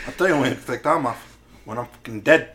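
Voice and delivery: put-on accented movie gangster threat; Scarface. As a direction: lay down the law like a drug lord. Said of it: "he's just sitting there like Scarface".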